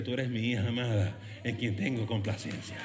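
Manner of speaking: God tenderly claiming a beloved daughter. Acted. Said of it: "es la voz que te abraza".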